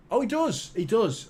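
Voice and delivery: high, quick jump of surprise; surprised realisation. Real, not acted. Host finds the missing super chat message, surprised. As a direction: pitch leaps up as you suddenly spot it.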